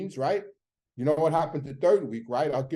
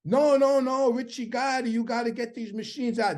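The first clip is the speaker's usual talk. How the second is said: panicking plea; 9 semitones higher than usual. Retold or imitated. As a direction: beg him in a rush of panic, voice up high. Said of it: "The guy's panicking".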